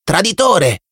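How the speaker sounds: high chanted accusation; children shouting. A narrator's reading. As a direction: yell it while hopping around him. (grido acuto di bambino)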